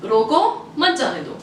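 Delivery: slow "रोको", a clear break, "मत जाने दो"; pause after रोको. Shown as a demonstration. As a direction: put the comma after रोको. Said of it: "अभी कॉमा चेंज करते हैं".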